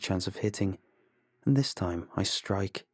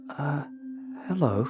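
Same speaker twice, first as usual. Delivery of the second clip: hesitant, higher-pitched greeting; anxious stranger; 7 semitones higher than usual. A narrator's reading. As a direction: uncertain hello with a pause. Anxious stranger greets armed men.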